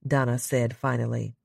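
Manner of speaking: usual speaking voice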